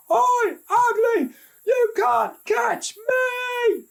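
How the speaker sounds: very high, slow, drawn-out yell. A narrator's reading. Little brother finally yells at the Banshee, high slow yell. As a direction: shout the taunt with all his might.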